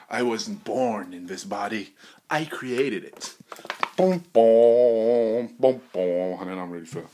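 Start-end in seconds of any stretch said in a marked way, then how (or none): 4.0-5.8 drawn-out, loud imitated booms; punchy vocal sound effect; loud "boom" noises.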